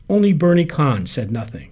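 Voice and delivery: ordinary delivery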